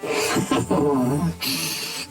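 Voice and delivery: low, sinister chuckle; villainous chuckle. Acted. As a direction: chuckle menacingly from the shadows. Villain catches the heroes.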